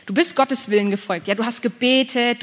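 usual speaking voice

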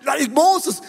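high-pitched, fired-up hype; acted burst of overexcited zeal; highly motivated. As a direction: rattle off bold vows like an unstoppable zealot. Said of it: "dann bist du so motiviert".

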